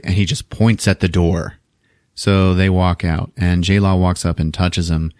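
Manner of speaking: normal talking voice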